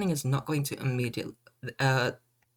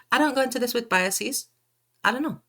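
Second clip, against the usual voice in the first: much higher, pleading that she has no biases; high mock innocence; 9 semitones higher than usual. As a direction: play it wide-eyed innocent.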